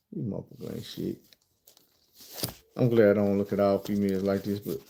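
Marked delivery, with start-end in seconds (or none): 0.1-1.2 low, quiet disgusted mutter; muttered under breath. Real, not acted. Fed-up muttered verdict.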